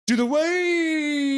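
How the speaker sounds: slow, high drawn-out shout; shouted cheer. Real, not acted. Streamer urging the crowd, a long high shout. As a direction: call the crowd to do the wave.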